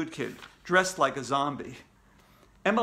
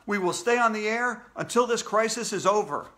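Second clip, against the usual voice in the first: raised, emphatic live-report voice; shouting into mic; 6 semitones higher, 3 dB louder than usual. A narrator's reading. AJ pledging coverage on air, shouting. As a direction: declare it like a crisis reporter. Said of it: "I shouted into the mic".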